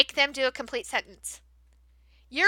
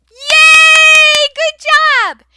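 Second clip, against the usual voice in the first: much higher, loud cheering; 12 semitones higher, 13 dB louder, much slower than usual. Shown as a demonstration. Teacher cheering two students' answer, loud. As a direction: cheer like they won.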